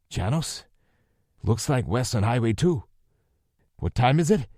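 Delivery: demanding. A narrator's reading. Lou demanding the time from a ranger. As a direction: press for the answer now. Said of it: "Lou demanded".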